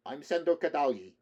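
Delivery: put-on loud, high Slavic-accented announcement. A narrator's reading. Russian man introduces himself to Rick, loud Slavic accent. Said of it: "the man asked with a Slavic accent".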